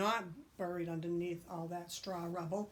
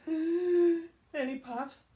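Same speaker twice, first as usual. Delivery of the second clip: slow loud gasping puff; wolf puffing at the sticks; huge puff; 9 semitones higher, 9 dB louder, much slower than usual. Acted. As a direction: blow with all your breath. Wolf blowing.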